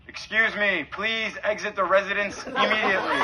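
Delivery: loud, official megaphone call; hammed-up police-style loudspeaker voice; mock megaphone announcement. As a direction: boom it out like a bullhorn order. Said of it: "like a quick".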